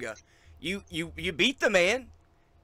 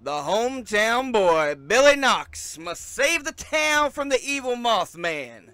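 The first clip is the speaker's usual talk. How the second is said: raised, louder ring announcer build-up; announcer hype; 5 semitones higher, 5 dB louder than usual. Acted. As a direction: sell the match like a fight-night host. Ring announcer hypes the match.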